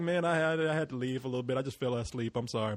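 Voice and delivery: sheepish excuse. Retold or imitated. Apologizing on the phone to a friend, soft and sheepish. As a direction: mumble a sleepy excuse. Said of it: "I was like".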